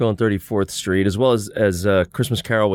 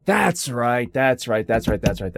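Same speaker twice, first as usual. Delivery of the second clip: ordinary talk, nothing marked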